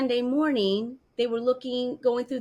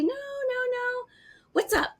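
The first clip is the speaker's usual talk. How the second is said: mom's high reply. Retold or imitated. Mom voiced in retold call.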